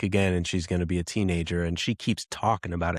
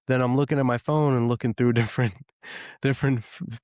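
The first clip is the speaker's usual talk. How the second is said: ordinary delivery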